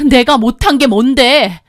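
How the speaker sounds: loud, defiant comeback; defiant shout. Acted. Wife shouting back at husband, defiant. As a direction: fire it back at him.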